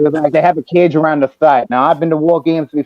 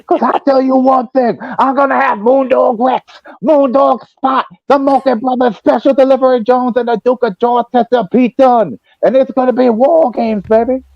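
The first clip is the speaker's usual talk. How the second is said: Dusty Rhodes shouting; 10 semitones higher than usual. Retold or imitated. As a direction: scream the card like a hyped wrestler. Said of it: "I was screaming".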